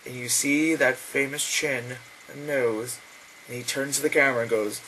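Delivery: ordinary delivery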